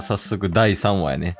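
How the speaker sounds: ordinary talk, nothing marked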